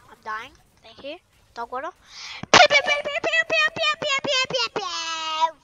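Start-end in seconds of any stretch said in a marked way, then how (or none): 2.5-5.6 very high loud cartoon laser pews; squeaky sci-fi blaster sounds; high pew noises. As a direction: fire a toy ray gun with your mouth. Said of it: "What just came out of my mouth".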